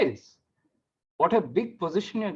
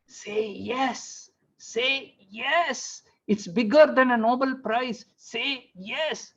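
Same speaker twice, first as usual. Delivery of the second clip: urging wife; 9 semitones higher, much slower than usual. Retold or imitated. Wife pressing husband to accept during his phone call, urging. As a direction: coax insistently in a high hushed voice.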